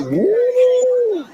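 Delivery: hyped whoop. Retold or imitated. Dad hearing his daughter trash-talk a rival, excited whoop. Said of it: "Got me all hyped".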